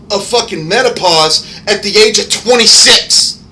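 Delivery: high, loud, indignant tirade; angry rant. Real, not acted. Ranting to viewers about a teacher, furious and loud. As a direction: spit it out in outrage.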